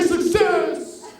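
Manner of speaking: high, loud shout; rallying shout. Shown as a demonstration. Speaker rousing the audience to shout, loudly. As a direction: drive the crowd to shout it. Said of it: "everybody say".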